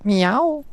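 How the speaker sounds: high playful meow; high cat meow; imitated meow. Real, not acted. Meowing back at the cat.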